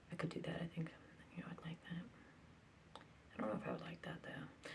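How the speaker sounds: hushed whisper to herself. Real, not acted. Musing to herself in a whisper. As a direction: breathe it out, barely voiced.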